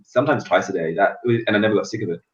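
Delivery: ordinary delivery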